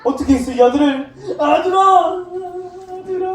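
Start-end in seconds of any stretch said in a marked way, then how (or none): 1.4-3.3 father calling his son. Acted. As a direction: play a father crying out to his returning son. Father calls to returning son. (애타게 아들을 부르는 아버지)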